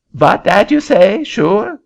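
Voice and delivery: put-on high, puzzled French-accented question; a bewildered Frenchman asking in a high French accent. Frenchman puzzled by the farmer's offer, high French accent.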